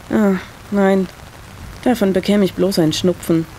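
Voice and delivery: usual speaking voice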